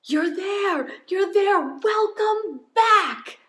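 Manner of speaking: high, loud, stretched-out welcome; crowd cheering. Retold or imitated. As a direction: cheer a runner over the line. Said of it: "they're saying".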